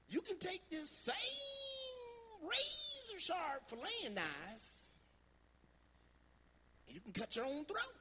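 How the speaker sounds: put-on high-pitched old southern grandpa with a twang; grandfather's high southern twang. Grandfather handing his grandson a knife, high twangy voice. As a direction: voice grandpa high and drawn, excited and then warning. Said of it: "that high voice here".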